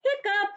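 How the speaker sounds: high sharp hiccup sound. Acted. Storyteller voicing elephant's hiccup, high and sudden.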